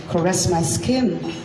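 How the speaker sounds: ordinary talk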